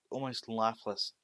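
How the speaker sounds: usual speaking voice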